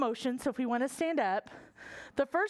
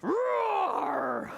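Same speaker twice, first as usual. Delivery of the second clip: huge dragon roar; 5 semitones higher than usual. Shown as a demonstration. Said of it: "I almost hurt my throat".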